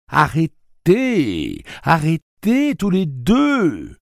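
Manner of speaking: very slow, high, loud plea to stop; a mother begging them to stop; drawn-out high cry, near tears; pleading cry. Acted. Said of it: "s'écria la mère de Jake".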